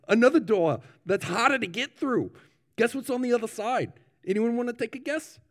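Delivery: high-pitched New Zealand minister; New Zealand accent. Acted. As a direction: quiz the congregation in a put-on New Zealand accent. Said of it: "I am gonna attempt, uh, the accent".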